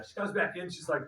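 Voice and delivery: usual speaking voice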